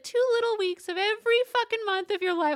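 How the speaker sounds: high, fast mocking voice of him; high mocking imitation; mocking mimicry. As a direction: squeak it out fast, mocking his offer. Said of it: "And he's like".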